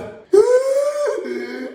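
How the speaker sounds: high wailing fake sob; hammed-up fake sob. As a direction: let out a staged wail. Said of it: "en mode acteur".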